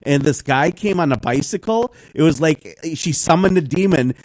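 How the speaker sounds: normal talking voice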